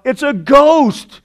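high, drawn-out cry of alarm; dramatized scared outburst; fearful cry. A narrator's reading. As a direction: cry it out like frightened disciples, stretching the last word. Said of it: "In their fear, they cried out".

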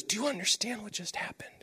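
whispered, almost no voice; retold awed whisper; hushed question. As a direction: lean in and breathe the question. Pastor's hushed question walking away.

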